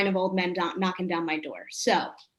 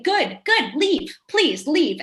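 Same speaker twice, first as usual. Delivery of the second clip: high, eager good good leave; shooing people out with glee; gleeful; 6 semitones higher, 4 dB louder, slower than usual. Acted.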